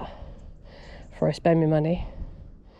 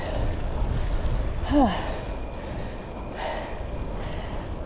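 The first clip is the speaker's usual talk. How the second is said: heavy sigh; 3 semitones higher, 6 dB quieter than usual. Real, not acted. Climbing hill on bike.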